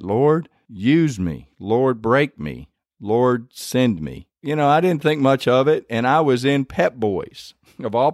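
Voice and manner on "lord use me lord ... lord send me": slow, each plea weighed apart; slow earnest prayer. Retold or imitated. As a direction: pray it slowly, one plea at a time. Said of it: "then I started praying that".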